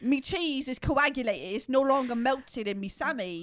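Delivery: hammed-up British grievance; mock British accent. Imitating the sandwich guy's complaint, exaggerated British accent. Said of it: "making fun of accents".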